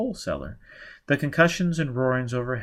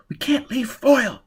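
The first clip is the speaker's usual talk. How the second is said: much higher, louder plea; desperate cry; 13 semitones higher, 5 dB louder than usual. Acted. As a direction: she refuses to leave him. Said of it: "Gisbella cried".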